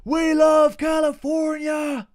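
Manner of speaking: high, slow, bellowed chant; mock screaming. Fan screaming his love for California to wind up locals. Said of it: "fucking screaming".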